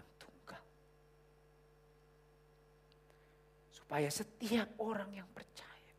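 whispered verse; hushed reading. Retold or imitated. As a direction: read the verse haltingly, barely aloud. Reads John 3:16 slowly.

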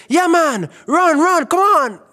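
retold dad cheering Jamaica in a Canadian section, a high loud yell. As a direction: belt it like a fan urging the players on.

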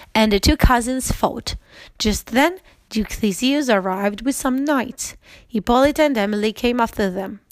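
ordinary delivery, nothing marked

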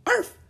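very high, loud yapping arf arf; small dog barking. A narrator's reading. Teddy the dog barking happily, very high yaps. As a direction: yap like a little puppy. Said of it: "Teddy barked".

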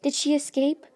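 loud, high-pitched urgent question; yelling. A narrator's reading. Luna demands whether Snow escaped, yelling. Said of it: "Luna yelled".

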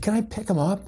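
eager volunteer asking the monkey handler. Retold or imitated. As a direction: ask like a thrilled kid. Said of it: "I said".

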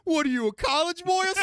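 high, loud put-on taunt; movie-quote voice. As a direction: call it out as an over-the-top film quote. Mock-quote thrown at co-host, then laughter.

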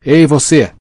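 narrator's restrained shout; shouted call. A narrator's reading. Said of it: "uma voz gritou".

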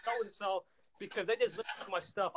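high, loud radio-host opener; pumped shout-out over the crickets; hyped shout-out. Retold or imitated. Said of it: "I would be like".

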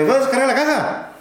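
high, sing-song Oaxacan worker; Oaxacan accent. Retold or imitated. Worker near Oaxaca asking the trucker about unloading, high Oaxacan accent. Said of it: "Ay, los oaxaqueños".

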